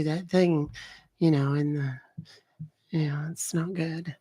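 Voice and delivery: put-on character voice, sweetly doting; fond birthday wishes, trailing into a hushed aside; Beverly. Beverly wishing a viewer's husband happy birthday, put-on doting voice. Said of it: "awesome if Beverly did a happy birthday".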